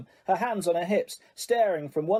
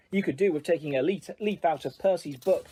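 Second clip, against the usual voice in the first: plain reading voice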